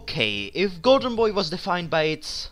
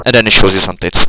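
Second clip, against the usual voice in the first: loud punchline; 7 semitones lower, 14 dB louder, much faster than usual. Real, not acted. Punchline after long pause.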